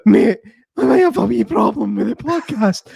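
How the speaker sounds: put-on high, squeaky breaking teenage voice; Kevin's teen squeak. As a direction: talk like a teen whose voice is cracking. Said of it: "Pretty good impression".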